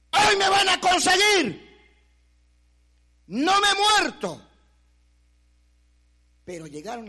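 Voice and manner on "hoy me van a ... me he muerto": high, loud, slow cry of relief; joyful shout. Retold or imitated. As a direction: shout it out, alive and triumphant. Realizes he survived the night. (grito de alegría, alto y lento)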